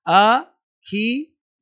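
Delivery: very slow "a... khi... le... sha"; syllable by syllable. Shown as a demonstration. Teacher checking the spelling with students, syllable by syllable. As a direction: break the name into separate drawn-out syllables.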